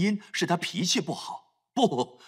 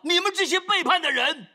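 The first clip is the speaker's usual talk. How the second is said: Moses's angry shout; 9 semitones higher than usual. Retold or imitated. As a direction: yell it at the rebels. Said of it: "所以他喊道". (摩西大声斥责百姓，满怀怒气)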